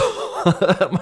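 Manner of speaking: high, quick delighted laugh; amused laugh. Real, not acted. Reviewer laughs as his wish comes true: high delighted laugh. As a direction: burst out laughing at your own wish.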